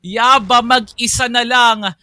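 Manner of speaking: loud hype commentary, some words stretched; esports caster. Real, not acted. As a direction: shout the call over the crowd. Second caster calling the decisive fight.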